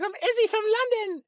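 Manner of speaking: put-on very high, squeaky British accent; hammed-up high-pitched British voice; British girl's accent. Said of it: "this British girl comes up to me".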